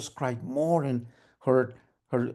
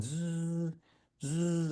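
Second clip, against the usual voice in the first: soft, droning "zzz"; sewing machine buzz; 2 semitones higher, 8 dB quieter than usual. Acted. Mom keeps sewing through tears, soft machine buzz. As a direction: imitate a machine that keeps running. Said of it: "she just kept on sewing".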